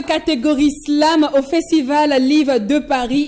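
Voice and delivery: ordinary talk, nothing marked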